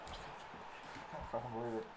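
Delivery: very soft, stunned disbelief; stunned mutter. Real, not acted. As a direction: say it under your breath, crushed. Robbed of a winning volley.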